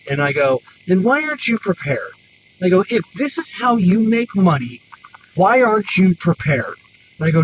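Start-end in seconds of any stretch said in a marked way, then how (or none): 0.9-2.1 exasperated retort, pitched up; retelling a fed-up comeback to a customer; annoyed. Retold or imitated. Mechanic challenging an unprepared customer, annoyed. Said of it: "That's when I got annoyed". 2.9-6.8 high, exasperated scolding; annoyed. Retold or imitated. Mechanic scolding a customer about being unprepared, annoyed. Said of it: "That's when I got annoyed".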